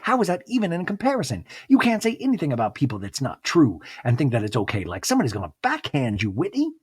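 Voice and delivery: playful threat, hammed up; imitating Mary's sassy warning; mock menacing Mary. Mary threatening in talking head.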